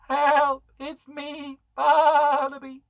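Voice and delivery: high, loud call for help, Barnaby drawn out slowly; a stuck sheep shouting to the shepherd. Acted. Lost sheep calling out.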